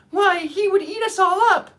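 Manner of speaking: high, loud squeak of alarm; the queen startled by the word lion; frightened mouse cry. Acted.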